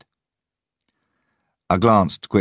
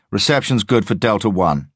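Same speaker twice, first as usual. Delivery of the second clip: police radio voice; 6 semitones higher, 5 dB louder than usual. A narrator's reading. Police radio check in ear.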